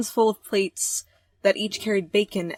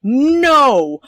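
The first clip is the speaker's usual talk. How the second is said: loud, high, drawn-out cry; panicked shout; 6 semitones higher, 15 dB louder than usual. Acted. Heroine stops someone getting the door, panicked shout. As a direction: shout it in alarm.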